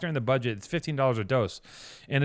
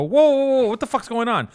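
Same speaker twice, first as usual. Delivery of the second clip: retold alarmed outburst; 10 semitones higher, 7 dB louder than usual.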